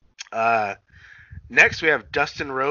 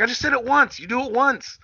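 higher, mock-stern retold scolding; 10 semitones higher than usual. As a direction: play the cocky heel, half-joking. Retelling Dustin scolding the ref.

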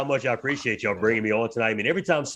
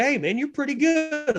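animated praise. Retold or imitated. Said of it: "He's still so animated".

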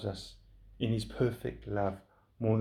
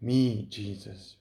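grumbling; 2 semitones lower, much slower than usual. Retold or imitated. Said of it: "And we grumble and we complain".

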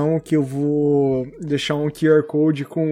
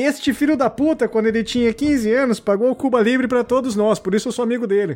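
quoting a friend; 7 semitones higher than usual. Retold or imitated. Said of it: "falou assim".

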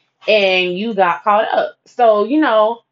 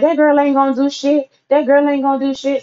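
higher, scoffing brush-off; dismissive friend; 7 semitones higher than usual. Retold or imitated. Friend scoffing at the shooting threat, dismissive. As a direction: wave it off with disdain.

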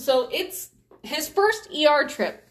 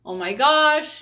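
louder, higher "Oh my gosh" burst out; a raised exclamation of distress; exclaiming; 4 semitones higher, 6 dB louder, slower than usual. Retold or imitated. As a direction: blurt it in alarm. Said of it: "and I'm like".

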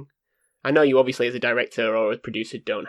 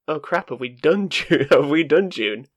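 slow, louder alarmed inner voice; mock panic; 4 semitones higher, 5 dB louder, slower than usual. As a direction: act out sudden worried realization. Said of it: "I was like".

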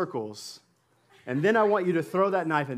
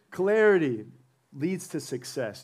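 usual speaking voice

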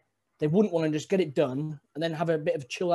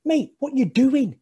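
baffled coworker; 11 semitones higher, 8 dB louder, slower than usual. Retold or imitated. Bewildered coworkers questioning him.

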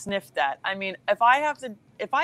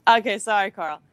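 ordinary talk, nothing marked

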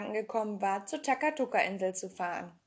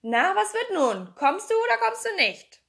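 loud, high, impatient shout; 9 semitones higher, 7 dB louder than usual. A narrator's reading. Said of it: "Er schrie wieder zu Pippi hinauf".

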